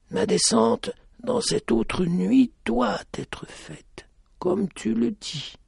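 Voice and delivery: slow high whisper. A narrator's reading. Blind lady whispers her agreement, slow. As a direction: breathe it out in an unvoiced whisper. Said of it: "ajouta-t-elle".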